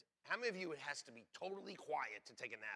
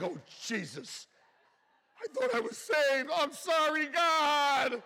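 much higher and louder hammed-up panic; mock panic; 10 semitones higher, 12 dB louder, slower than usual. Pastor acting out panic over a missed rapture, hammed-up fright. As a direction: squeal it like you got left behind.

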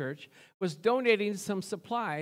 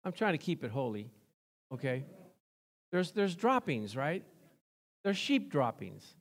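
normal talking voice

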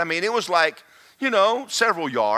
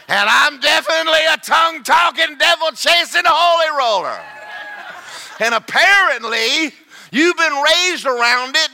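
higher and louder, triumphant laughing rant; gleeful shout; 10 semitones higher, 6 dB louder than usual. Retold or imitated. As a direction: crow it back at him, loving every word. Retold gleeful comeback to angry man.

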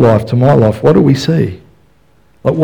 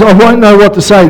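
high, pleading protest; whiny Moses again; 7 semitones higher, 4 dB louder than usual. Retold or imitated. Moses repeating his excuse to God. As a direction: whine the excuse once more. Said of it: "Then he says".

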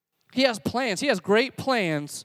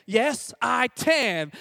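put-on slow, raised little-boy echo; toddler saying it louder; 4 semitones higher, much slower than usual. Toddler repeats louder after his dad, slow raised voice. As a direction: repeat it higher, drawn out like a toddler. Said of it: "Say it louder, M J".

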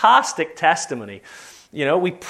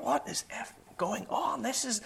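censored swearing; 5 semitones higher, 13 dB quieter than usual. Retold or imitated. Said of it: "Starts to swear".

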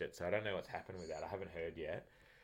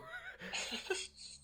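burst of laughter; 4 dB quieter than usual. Real, not acted. Laughing after umpire story.

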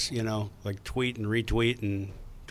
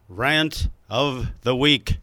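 slow, drawn-out title announcement; segment announcer; 4 dB louder, much slower than usual. Pretend. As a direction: announce it word by word, mock-grand. Announces the segment title, mock echo.

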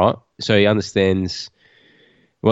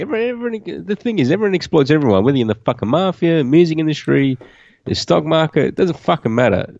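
excited rant; 8 semitones higher than usual. Real, not acted. Heated rant about exploitation.

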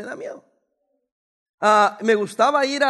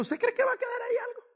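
high rushed exclamation; excited; 13 semitones higher, 8 dB quieter than usual. Real, not acted. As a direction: fire it off high and fast. Mocking falling plane.

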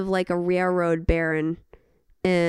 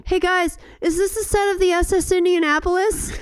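cheery call; 11 semitones higher, 4 dB louder than usual. Pretend. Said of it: "was like".

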